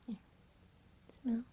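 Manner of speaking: ordinary talk, nothing marked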